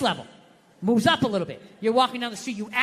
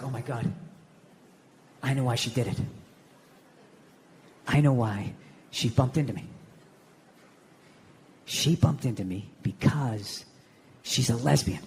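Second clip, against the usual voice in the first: hushed revelation; 12 semitones lower, 4 dB quieter than usual. Retold or imitated. Man realising at the sink why she did it, low solemn revelation. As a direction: drop deep and let each phrase hang.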